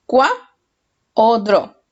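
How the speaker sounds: "Cua", a pause, then "o dro"; syllables offered separately. Shown as a demonstration. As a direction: set "Cua" apart from "dro" as two choices. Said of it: "qué sílaba tiene el acento prosódico". ("Cua" y "dro" por separado, como opciones)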